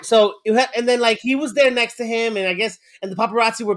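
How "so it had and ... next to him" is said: ordinary talk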